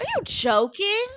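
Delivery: high, stunned question; can't believe what she just heard; shocked disbelief. Real, not acted. Shocked by news it's fake.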